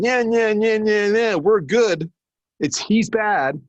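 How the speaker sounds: lightly hammed-up brush-off, then slow blame; dismissive brush-off. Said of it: "instead of me being a dick and going".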